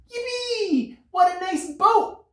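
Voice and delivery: tiny, very high ant voice. A narrator's reading. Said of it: "cried the ant".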